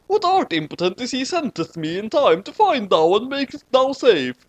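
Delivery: high, excited mock-Shakespearean girl; Shinigami girl voice. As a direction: pitch it up like an eager teen Shinigami. Teosifj speaks at length.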